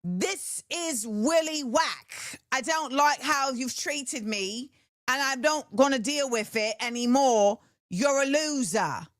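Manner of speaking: put-on slow, blunt British-accented put-down; mock British accent. Imitating the rapper calling someone out, mock British accent. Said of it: "No, she's like".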